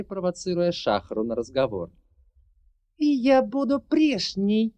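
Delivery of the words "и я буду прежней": high, slow hate-filled cry; hateful shout. Acted. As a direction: shout it with hatred. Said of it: "закричала проклятая магиня с ненавистью".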